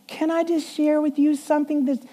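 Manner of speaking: high, gentle secretary voice; sweet small voice. Retold or imitated. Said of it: "she kinda talked like this".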